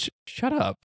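high, soft stammered shut up; dismissive. Retold or imitated. The streamer telling Rogan to shut up.

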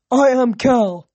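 loud, proud brute announcing himself; big dumb lug, full volume; grunting ox. A narrator's reading. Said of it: "the ox grunted".

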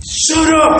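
sudden high shouted command; robber's angry order. Retold or imitated. Robber with gun to her head, high angry shout. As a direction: act out a gunman shouting her down. Robber jumps counter at the woman.

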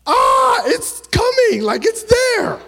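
very high, loud excited cry. Retold or imitated. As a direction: shout it like the kick is happening now. Reliving baby moving.

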